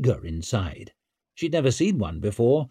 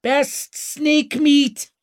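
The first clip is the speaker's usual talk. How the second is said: high, loud, drawn-out street hawker's cry; screamed sales cry; 5 dB louder, much slower than usual. A narrator's reading. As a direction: call your wares out slowly and shrilly. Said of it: "screamed a woman".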